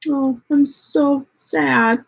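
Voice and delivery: drawn-out fake despair; mock sadness. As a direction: pretend to be crushed, clearly mocking. Sarcastic reply to a hater.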